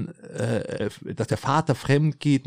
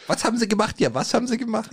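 mocking high voice; 11 semitones higher than usual. Teasingly echoing the co-host's question.

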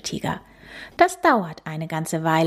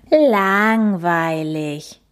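slow drawn-out grumble; grumbling; 3 dB louder, much slower than usual. Acted. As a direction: stretch it out sulkily. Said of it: "mault der kleine Nasenbär".

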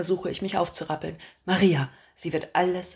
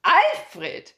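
put-on much higher, loud old-lady bellow of a name; old woman yelling; 11 semitones higher, 6 dB louder than usual. A narrator's reading. Old woman bellows at her husband Alfred. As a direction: yell the husband's name urgently. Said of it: "höre ich die Alte brüllen".

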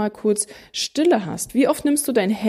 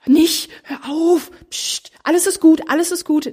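high, loud hammed-up soothing; mock soothing parent; 7 semitones higher, 3 dB louder than usual. Parent calming a crying baby, high hammed-up soothing. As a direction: parody a parent hushing a baby. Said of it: "dass alle immer sofort".

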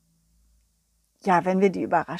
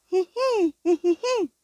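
high giggle; dog's little titter; high squeaky giggle; giggly dog; 9 semitones higher than usual. Acted. Balli giggles.

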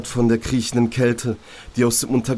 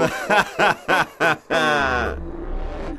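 inner voice laughing; 5 semitones higher than usual. Acted. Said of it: "fängt wieder an zu lachen".

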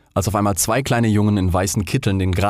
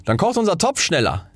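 lightly voiced boy, higher; 9 semitones higher than usual. A narrator's reading. Boy planning to boil the pot, lightly boyish. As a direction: say it higher, like a lad. Second boy speaks in story.

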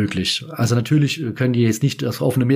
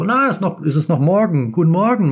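higher, louder cheerful mock greeting; imitating Siegfried; 7 semitones higher, 4 dB louder than usual. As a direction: play the old showman greeting a fan warmly. Siegfried greets her, retold. (höher und lauter, gespielt fröhlicher Gruß)

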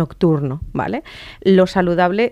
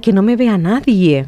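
louder, acted-out plea to hide tears; mock embarrassed crier; 8 dB louder than usual. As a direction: play someone flustered at being seen crying. Acts out hiding one's crying.

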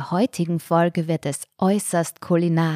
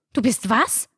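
higher, incredulous question; a touch of cracking agitation; agitated, voice cracking; 5 semitones higher than usual. A narrator's reading. Mother aghast at daughter leaving church, agitated and higher. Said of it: "flüsterte sie aufgeregt. Ihre Stimme überschlug sich dabei".

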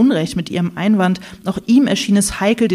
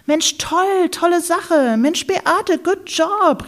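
high, gushing exclamations; excited group cheer; 11 semitones higher, much slower than usual. Acted. Friends at the meeting cheering Beate, high and gushing. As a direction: cheer her on, everyone at once. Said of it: "riefen alle durcheinander".

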